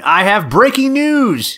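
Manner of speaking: high, slow breaking-news announcement; news anchor voice. Pretend.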